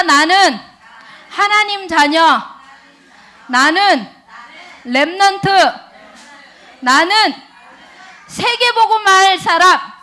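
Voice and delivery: loud, slow declaration in short phrases; rallying call-and-response. Real, not acted. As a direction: proclaim it for the crowd to repeat. Leads congregation in repeated declaration. (크고 또박또박 선창하듯 선포)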